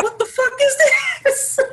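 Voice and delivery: imitating his friend watching the fight; mock disbelief. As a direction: squeak out the disbelief. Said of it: "A J was like".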